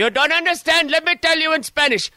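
mock Spanish accent, insisting. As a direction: play up a Spanish accent in frustration. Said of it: "What is that accent?".

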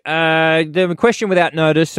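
ordinary delivery

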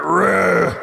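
a loud, gnarly roar at the surfers; mock roar. Retelling the Predator charging surfers, mock roar. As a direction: bellow it like a thug charging in. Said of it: "he was just like".

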